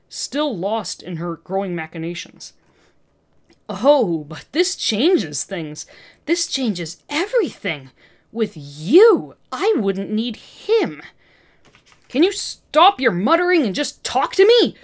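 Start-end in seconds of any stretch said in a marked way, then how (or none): 3.7-11.0 slow, gloating villain murmur; scheming villain. Acted. Olivia gloating to captive Peter, slow and scheming. 12.1-14.8 loud angry snarl, higher pitched. Acted. Captive Peter demanding Olivia answer him, loud snarl. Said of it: "Peter snarled".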